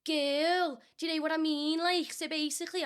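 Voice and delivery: lilting Scouse, starting high; chatty Liverpool impression; Liverpool accent. Shown as a demonstration.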